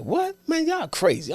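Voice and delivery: high, indignant "What?" then scoffing; outraged disbelief. Retold or imitated. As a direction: shout your disbelief at the staff. Told he must stay longer.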